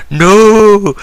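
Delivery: high, loud cry of no; anguished no. Acted. Cena pinned in the ring cries out, high and loud. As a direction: wail it as if pinned and beaten. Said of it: "Cena's crying like".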